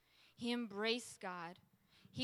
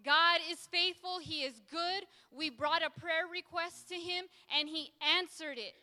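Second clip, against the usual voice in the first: raised, high-pitched declaration; bold, exultant proclamation; proclaiming shout; 6 semitones higher, 5 dB louder than usual. Acted. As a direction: shout it out as good news. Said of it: "We can shout it".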